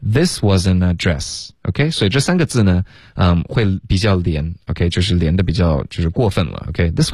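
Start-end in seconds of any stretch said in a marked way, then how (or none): 0.0-1.5 "This wasn't address" slow, wasn't sliding straight into address, t dropped; linked speech demo. Shown as a demonstration. Teacher showing the class the linking, slow and joined. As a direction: join "wasn't address" into one smooth stretch, slowly. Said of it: "wasn't跟address要连读".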